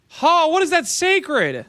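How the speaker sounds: high, slow mocking laugh and scoff. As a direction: scoff at a bad price. Mocking a low offer.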